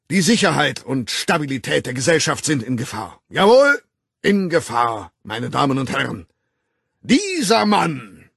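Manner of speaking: loud, high, formal public denunciation; a director condemning an employee before the staff; stern director's speech. Acted. Director denouncing Bernard to the assembled staff, loud and stern.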